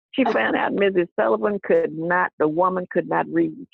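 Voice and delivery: ordinary talk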